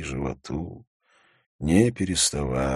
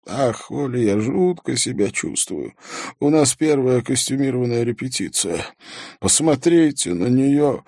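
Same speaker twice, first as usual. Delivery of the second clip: higher-pitched, lightly fretful producer; mildly distraught producer; 7 semitones higher, 3 dB louder than usual. Acted. Producer confiding in policeman at rehearsal, mildly distraught. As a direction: pour out your unease, pitch lifted a little.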